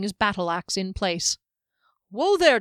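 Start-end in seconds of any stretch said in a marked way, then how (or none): 2.1-2.6 high, loud whoa; calling out. Acted. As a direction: rein him in like a horse. Restraining the raging dwarf.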